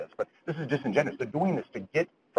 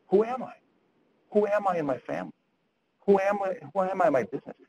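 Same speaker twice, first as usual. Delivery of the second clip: lost client; 6 semitones higher than usual. Retold or imitated. Depressed client voiced.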